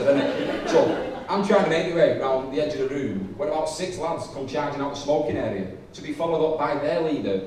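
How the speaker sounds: usual speaking voice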